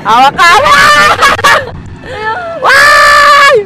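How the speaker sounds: exhilarated thrill-ride shrieks; real ride screaming. Real, not acted. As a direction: scream and whoop through every drop. Screaming and urging partner to scream.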